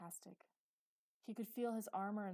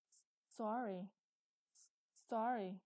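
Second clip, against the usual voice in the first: frightened stutter on the first sound; 3 semitones higher, 7 dB louder, slower than usual. A narrator's reading. Frightened boy apologising to his uncle, stuttering. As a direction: stammer out a loud apology. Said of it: "he stuttered".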